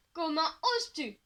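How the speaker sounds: hammed-up indignation, hand on heart; mock offense. A narrator's reading. Boy teasing his girlfriend's twin, mock offended. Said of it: "s'offusque-t-il".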